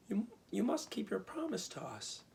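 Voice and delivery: put-on high, urgent girlish cry; exclaiming. A narrator's reading. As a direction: insist on the promise, voice up high. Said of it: "exclaimed Dorothy".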